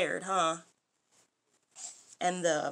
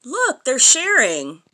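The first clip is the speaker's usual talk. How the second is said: plain reading voice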